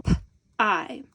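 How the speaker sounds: P-I as a puff "p", gap, long "eye"; sounded out. Shown as a demonstration. Teacher showing an open syllable, sounded out. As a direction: split P-I into its two sounds. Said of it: "we have this open syllable".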